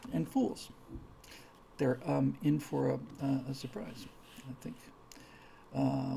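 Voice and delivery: usual speaking voice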